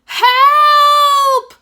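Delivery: very high, very loud scream; scream for help. Acted. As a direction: scream it as you drift away. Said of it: "Cole screamed".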